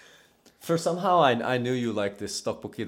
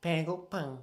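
cute aegyo; 3 semitones higher, 6 dB quieter, faster than usual. Retold or imitated. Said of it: "Would you like to give a shot?".

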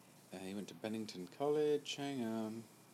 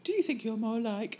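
high, loud put-on quizmaster voice; loud, high-pitched send-up of a personality quiz; mock quiz question; 12 semitones higher, 6 dB louder than usual. Mock-reading an online quiz question.

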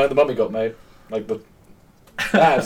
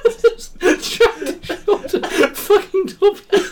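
laughing; much slower than usual. Real, not acted. Helpless laughing fit.

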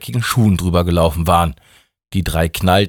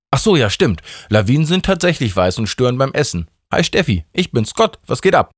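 high, breezy, chummy god; a laid-back deity dropping by; casual high God voice; 6 semitones higher than usual. Acted. (lockerer, hoher Gott)

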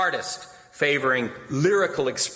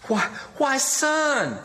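soothing, much higher pleading tone; smooth things over with the son; diplomatic; 11 semitones higher, much slower than usual. A narrator's reading. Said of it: "said Eliza diplomatically".

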